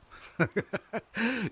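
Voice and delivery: high laugh of triumph; short, high-pitched gloating laugh; triumphant laugh. A narrator's reading. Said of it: "with a laugh of triumph".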